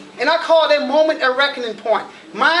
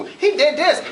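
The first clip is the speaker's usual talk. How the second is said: ordinary delivery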